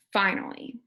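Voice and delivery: usual speaking voice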